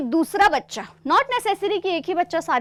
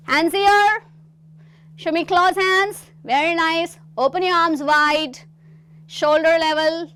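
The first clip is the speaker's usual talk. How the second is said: raised, energetic instructions; coaching cues; 4 semitones higher, 4 dB louder, much slower than usual. Shown as a demonstration. Teacher guiding students through gestures, brisk and encouraging. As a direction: call out the moves like a drama coach. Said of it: "coaching the class gestures".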